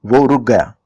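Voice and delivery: ordinary delivery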